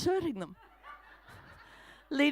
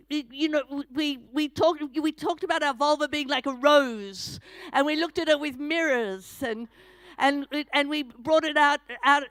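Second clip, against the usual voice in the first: usual speaking voice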